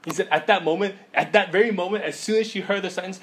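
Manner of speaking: ordinary delivery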